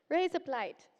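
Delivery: American words blurred into Aussie "razor blade"; Australian accent. Shown as a demonstration. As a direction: say "Raise up light" run together, Aussie-sounding. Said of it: "razor blade in an Australian accent".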